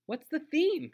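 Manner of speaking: put-on very high, loud little-kid question; very excited. A narrator's reading. Small girl asks about the party she's invited to, very excited. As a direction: squeal the question with eager excitement. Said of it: "Sweetie Pie was very excited when I invited her".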